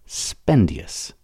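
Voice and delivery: plain reading voice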